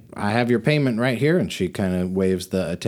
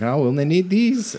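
cart driver; 8 semitones higher, 3 dB louder than usual. Acted. GM voices cart driver tossing coffins.

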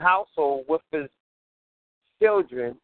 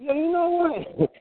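high exclaim; 9 semitones higher than usual. Retold or imitated. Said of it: "I was like".

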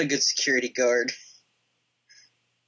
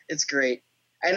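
ordinary talk, nothing marked

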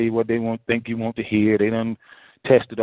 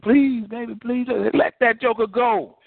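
higher, mock pleading, slowing on the end; imitating begging; 10 semitones higher, slower than usual. Preacher mimics a begging partner for the congregation, higher mock plea. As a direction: whine like a jilted lover. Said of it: "begging women".

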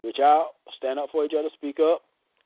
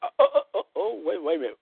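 mock surprise; 5 semitones higher, faster than usual. Acting out watching her fall.

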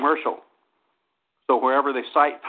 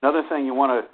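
usual speaking voice

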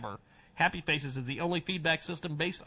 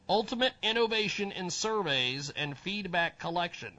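normal talking voice

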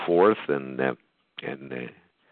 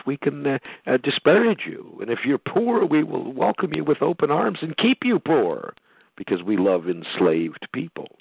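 mocking the government; 10 semitones higher than usual. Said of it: "government organization".

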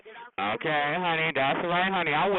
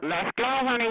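ordinary talk

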